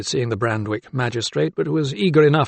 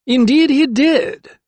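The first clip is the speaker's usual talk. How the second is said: high, slow, louder magistrate; 13 semitones higher, 6 dB louder, slower than usual. A narrator's reading. Magistrate confirming the confession, high and slow. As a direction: draw it out, pitched right up.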